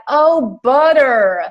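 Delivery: put-on butter in an American accent, high and loud; exaggerated American accent on butter; American server's butter. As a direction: mimic an American's dawning "oh, butter", D for T. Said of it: "finally she was like".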